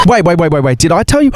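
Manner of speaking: high, rushed oh my God; suddenly remembering something big; breathless high excitement; excited gasp. Real, not acted.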